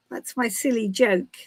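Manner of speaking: usual speaking voice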